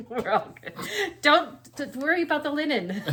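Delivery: laughing. Real, not acted. Laughing about the mishap.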